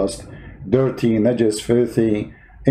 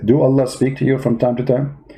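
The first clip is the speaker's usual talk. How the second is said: ordinary talk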